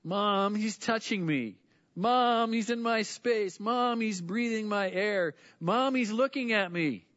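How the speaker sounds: put-on high, loud whining kid tattling to mom; whiny tattling little sibling. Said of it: "some of these will sound so familiar to you".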